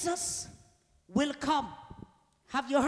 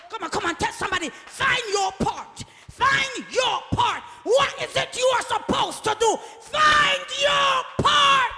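rally the church, each repeat higher and bigger; full-force preaching; 10 semitones higher, 9 dB louder than usual. Real, not acted. Preacher urging neighbours to tell each other, building shouted exhortation.

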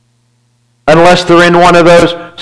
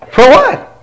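high disbelieving rise on what; incredulous question; 9 semitones higher than usual. Shown as a demonstration. Pastor repeating to the church that he had nothing to apologize for, incredulous. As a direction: push it up, genuinely baffled.

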